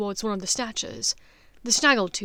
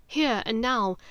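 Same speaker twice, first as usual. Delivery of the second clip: plain reading voice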